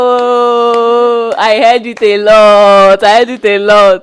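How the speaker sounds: loud and high, slow, laughing; excited recognition. Real, not acted. Laughing to her friend about Ghanaian pidgin, excited. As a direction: exclaim it, delighted to recognize it.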